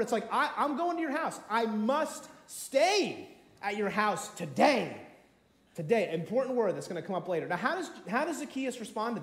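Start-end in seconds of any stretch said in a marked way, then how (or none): 0.3-5.0 "must" and "stay" drawn out with force; emphatic insistence. Acted. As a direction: insist slowly, leaning hard on "today". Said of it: "not even just, "Can I come over?".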